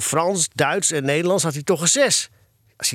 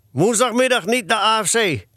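strict father's ban in a higher voice; raised-pitch, stern parental decree; 7 semitones higher than usual. A narrator's reading. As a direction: lay down the punishment firmly. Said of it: "dan klonk het streng".

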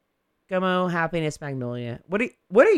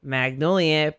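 normal talking voice

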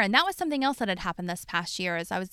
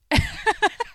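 loud, high burst of laughter; high laugh of outrage; shocked laugh; 13 semitones higher, 4 dB louder than usual. Real, not acted. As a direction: let out a disbelieving laugh. Laugh after his remark.

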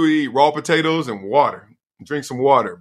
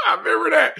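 high burst of laughter; faster than usual. Real, not acted. Laughing at memory.

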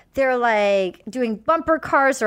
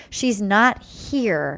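slow, sad quote; 3 semitones lower, much slower than usual. Retold or imitated. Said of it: "comes to the door and says".

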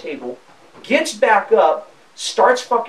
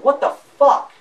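higher-pitched, incredulous outburst; retold disbelief; 8 semitones higher, 4 dB louder, slower than usual. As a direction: act out your own baffled outrage. Retelling his startled reaction to her.

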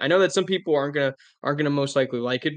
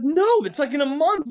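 much higher, louder mock protest as the wife; wife's protest; 12 semitones higher, 4 dB louder than usual. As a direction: squeal back that it's a month away. Voicing wife refusing.

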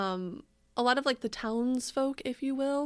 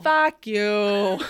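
loud, hammed-up Boston-accented curse; mock New England accent; 10 dB louder than usual. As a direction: belt it out in a joke Boston accent. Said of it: "Love a British accent".

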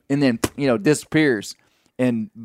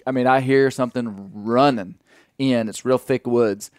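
ordinary delivery

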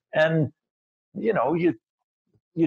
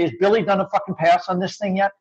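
higher, testy demand; mock hissy fit; 5 semitones higher than usual. Actor in rehearsal asking about the script pass, mock hissy fit. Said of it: "I'd have the hissy fit".

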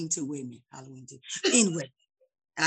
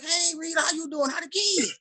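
high-pitched, sociable hello; chirpy greeting; 8 semitones higher than usual. Retold or imitated. Shopper chatting with cashier Rita, chirpy greeting. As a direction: greet an acquaintance in a raised, lilting voice.

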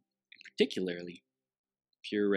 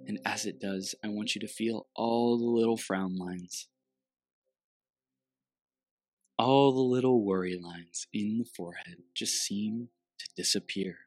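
guided meditation. Acted.

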